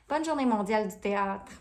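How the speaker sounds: in French. Shown as a demonstration. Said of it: "sounds better in French for me".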